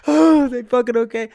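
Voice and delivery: high, loud burst of relief; overjoyed relief. Retold or imitated. Realizing his family is safe, loud joyful relief. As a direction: shout it out in relief.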